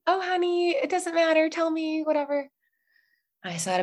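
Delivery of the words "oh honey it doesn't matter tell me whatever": high, soft imitation of a sweet Southern mom; sweet Southern accent. Mom reassuring her nervous child, sweet Southern accent. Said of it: "My mom in her sweet Southern accent said".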